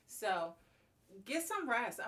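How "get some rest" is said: emphatic urging. Real, not acted. Urging listeners to rest.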